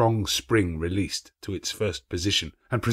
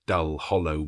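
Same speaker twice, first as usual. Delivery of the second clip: usual speaking voice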